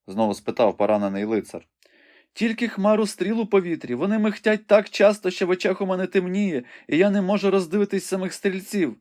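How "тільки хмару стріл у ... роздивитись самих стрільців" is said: high-pitched frightened maiden at the window; young woman Rebecca, anxious. A narrator's reading. Rebecca tells the wounded knight what she sees, high and anxious.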